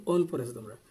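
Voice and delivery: ordinary talk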